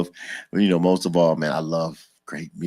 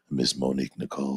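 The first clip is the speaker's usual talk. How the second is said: deep radio DJ announce; 7 semitones lower, 6 dB quieter than usual. Shown as a demonstration. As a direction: drop low like a late-night DJ. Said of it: "That's his D J voice".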